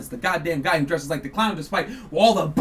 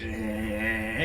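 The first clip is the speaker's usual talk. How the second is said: slow, low, dopey grunt; mock a man zoning out; imitation of a dazed husband's grunt; imitating his vacant grunt; 11 semitones lower, 9 dB quieter than usual. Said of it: "he's like".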